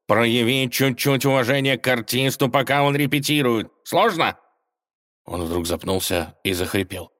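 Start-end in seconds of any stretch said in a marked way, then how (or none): 0.1-4.4 indignant. Acted. Indignant cat continues.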